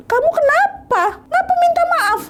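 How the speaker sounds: high-pitched bewildered husband; confused husband. Acted. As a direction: ask in high disbelief.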